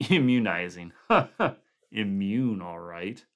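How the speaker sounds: bitter snickering laugh. A narrator's reading. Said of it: "Clark snickered".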